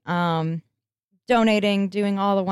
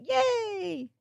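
cheering; 12 semitones higher, 5 dB quieter than usual. Real, not acted. Cheering after the chug.

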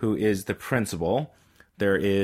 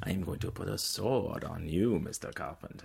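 creepy whisper; 3 semitones lower, 10 dB quieter than usual. Acted. Mock knighting bit.

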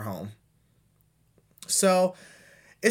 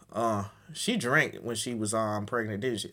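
hushed catty aside; 7 semitones lower, 5 dB quieter than usual. Retold or imitated. Imagined snide first thought.

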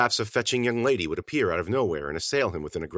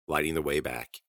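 ordinary delivery

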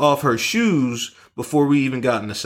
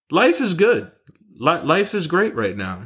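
blissful sigh; 6 semitones higher than usual. Retold or imitated. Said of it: "I thought to myself".

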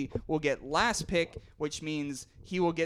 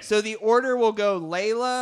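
a bit louder, cutting through the chatter; louder restart; 4 semitones higher, 8 dB louder than usual. Real, not acted. Host to noisy guests, repeating the turn order, louder. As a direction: raise the voice to get heard over the room.

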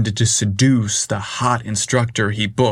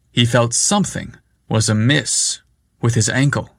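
plain reading voice

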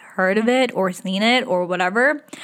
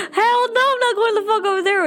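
freaked-out refusal; 13 semitones higher, faster than usual. Retold or imitated. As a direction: squeal it out like you're terrified, rushing. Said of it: "I'd be like".

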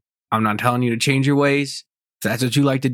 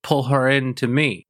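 ordinary delivery

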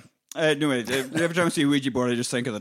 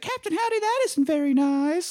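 put-on little girl scolding. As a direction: tut like a prissy child.

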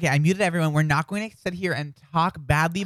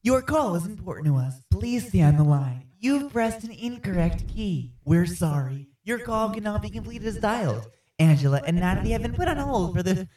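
phone menu voice; 3 semitones higher than usual. Pretend. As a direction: read it like an automated phone line. Mock automated hold message.

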